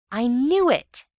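high, loud burst of triumph; excited outburst. A narrator's reading. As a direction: blurt it out in triumph. Ewbank bursts out.

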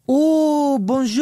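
put-on drawn-out, high French-accented realisation; exaggerated French accent. French girl finally understanding, exaggerated French accent.

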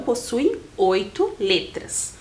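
ordinary talk

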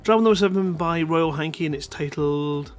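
ordinary talk

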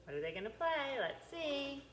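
a touch of sing-song read-aloud; bright, coaxing read to little ones; reading to a toddler. Acted. Reading picture book to toddlers.

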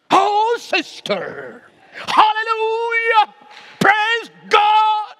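high, drawn-out hallelujah shouting. Retold or imitated. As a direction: wail it out like a hammed-up revival preacher.

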